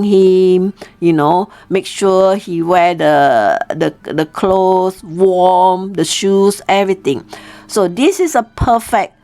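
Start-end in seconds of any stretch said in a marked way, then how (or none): none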